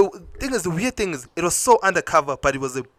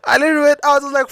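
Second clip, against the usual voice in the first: fast, high, laughing through the words; 12 semitones higher, 5 dB louder, much faster than usual. Real, not acted. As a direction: laugh as you tell it. Laughing while retelling walking out.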